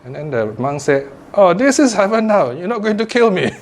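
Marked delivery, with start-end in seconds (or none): 1.3-3.5 high-pitched punchline breaking into laughter; amused relief. Acted. Monk tells swordsman he is spared, amused relief. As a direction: land the monk's relieved line with a chuckle.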